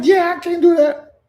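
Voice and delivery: very high, loud mock-desperate cry; loud refusal. Vlogger replaying her refusal to friends, high loud cry. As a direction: wail the refusal at them.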